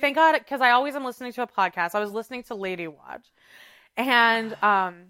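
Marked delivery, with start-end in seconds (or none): 0.0-0.4 quick, higher, louder thank God; relieved exclaim. Retold or imitated. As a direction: blurt out relief. Retold relief quote, louder higher.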